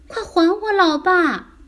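high, loud demand; desperate shout. Acted. Child shouting into the ice hole for dad's return. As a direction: call angrily down into a hole. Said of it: "谢里尔大叫起来， 他朝洞口喊".

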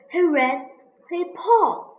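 plain reading voice

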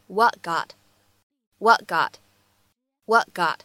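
"what got" slowly joined, no release on the t of "what"; lost plosion. Shown as a demonstration. Teacher demonstrating lost plosion, "what got" with an unreleased t. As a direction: hold the t in "what" and go straight into "got". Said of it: "是一个非常典型的失去爆破".

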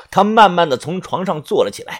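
ordinary delivery

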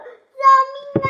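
high, loud wail for help; stuck kid wailing for rescue; panicked cry for help. Acted. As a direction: scream for help from up the tree. Daniu stuck, crying for help.

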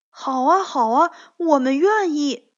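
high, loud, delighted agreement; happy, eager. A narrator's reading. Two friends gladly agree to keep granny company, excited. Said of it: "两个好朋友高兴地说".

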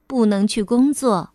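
ordinary delivery, nothing marked

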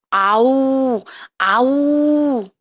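loud mock monster chomp; chomping roar. Hungry turtle lunges at the toads, loud mock chomp. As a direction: snap the jaws with a loud playful roar.

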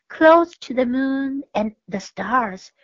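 ordinary delivery

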